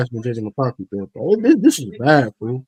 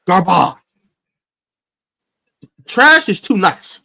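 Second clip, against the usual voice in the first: slow, building into a loud angry outburst; heated rant; 3 semitones higher, 4 dB louder than usual. Real, not acted. Fan rants about his quarterback, building to an angry yell. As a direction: rant harder and harder until it bursts.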